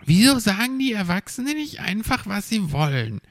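high, fast indignant whine; complaining little creature. A narrator's reading. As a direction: gripe quickly in a small high voice. Little Borstel keeps complaining.

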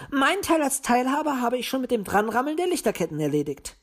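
high-pitched, demanding little owl; high squabbling owl; bickering owl. A narrator's reading. Owl Paul bickers with Willi.

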